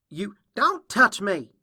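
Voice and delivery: high, loud, slow warning; angry rebuff. Acted. Filly blocking off Applejack's touch, angry rebuff. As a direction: push them off with each loud word.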